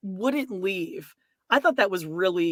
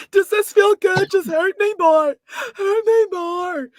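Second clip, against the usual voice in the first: high, loud hammed-up moaning plea; mock sultry Lisa; 11 semitones higher, 7 dB louder than usual. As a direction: camp mock begging, drawn out at the end. Mocking Lisa in sexual parody.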